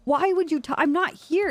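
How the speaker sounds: high-pitched retold protest; exasperated protest. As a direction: act out your disbelief at a friend's blunder. Retold quarrel with Winston.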